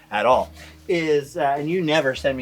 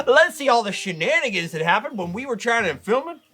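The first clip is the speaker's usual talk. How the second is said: host announcer voice; 10 semitones higher than usual. Acted. Announces B-roll.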